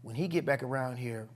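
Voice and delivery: low, soft self-talk; quiet resolve. Retold or imitated. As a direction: murmur the plan under breath. Kid psyching himself up quietly.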